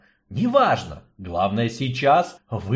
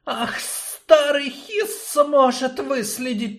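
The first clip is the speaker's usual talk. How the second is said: snake voice; 14 semitones higher, slower than usual. Acted. Snake offers help.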